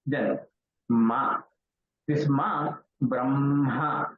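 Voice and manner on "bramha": brahma said bramha, m hummed before ha; correct m-h order. Shown as a demonstration. Teacher models ma with ha, carefully pronounced. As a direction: say "brahma" with a long m released into ha. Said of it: "So ma has to come to be pronounced".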